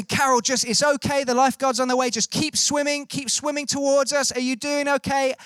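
retold calling out across water, pitched high; encouraging call to someone far off, held high; calling over. Rescuer to woman drifting in the sea, calling over reassuringly. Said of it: "we're just calling over to the".

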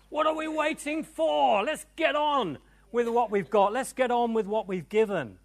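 high, loud preacher's rallying cry; rousing exhortation. Real, not acted. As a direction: urge the crowd to get moving. Preacher urging congregation to act.

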